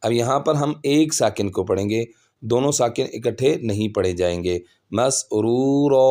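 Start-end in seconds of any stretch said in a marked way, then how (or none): 4.9-6.0 "مسرورا" slowly, ending on long open raa; stopped ending. Shown as a demonstration. Teacher modelling how to read it, "مسرورا" with long final aa. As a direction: draw out "مسرورا", dropping the final n sound. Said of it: "ایسے پڑھیں گے".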